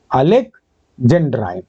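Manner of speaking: Alexandrine slowly, split in two with a pause; slow dictation. Shown as a demonstration. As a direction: dictate Alexandrine in parts, first part rising, then falling. Teacher repeats term in parts.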